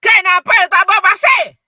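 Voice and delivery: high, loud sharp demand; harsh scolding shout; angry yell. Shown as a demonstration. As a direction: bark the question at the child. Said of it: "saya tunjuk eh".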